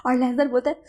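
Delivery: ordinary delivery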